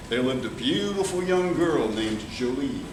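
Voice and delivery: usual speaking voice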